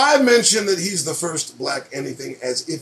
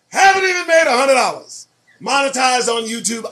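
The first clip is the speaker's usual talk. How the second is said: exasperated shout; 5 semitones higher, 4 dB louder than usual. Real, not acted. Exasperated about earnings.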